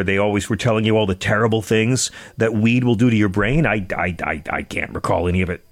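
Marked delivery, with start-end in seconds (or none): none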